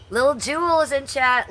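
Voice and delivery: light up to greet a friend; excited greeting. Real, not acted.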